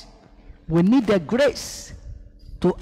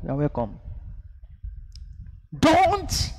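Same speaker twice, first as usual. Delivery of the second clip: normal talking voice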